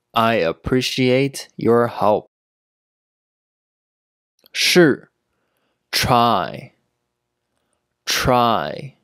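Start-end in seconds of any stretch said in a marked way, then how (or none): none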